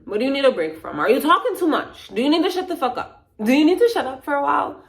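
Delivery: high, loud, insistent questioning; yelling. Real, not acted. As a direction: raise pitch and volume, pushing each question.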